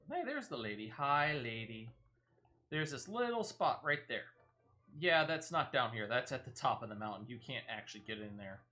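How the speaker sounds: ordinary talk, nothing marked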